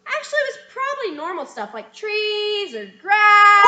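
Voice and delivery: loud, carrying stage voice; projected stage line. Shown as a demonstration. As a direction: say it for the whole audience to hear. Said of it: "it'll kind of sound like this".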